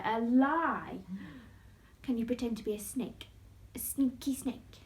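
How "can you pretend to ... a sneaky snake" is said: plain reading voice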